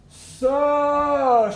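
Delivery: high, sing-song drawn-out So; sing-song Mama San. Retold or imitated. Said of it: "she would say".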